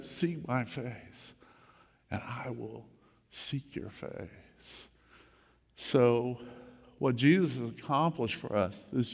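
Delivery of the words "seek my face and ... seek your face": slow, soft and whispered at the end; hushed prayer. Acted. Pastor praying Psalm twenty-seven to his congregation, slow and hushed. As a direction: let the prayer fade into a whisper.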